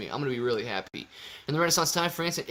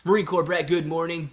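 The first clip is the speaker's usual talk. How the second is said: ordinary delivery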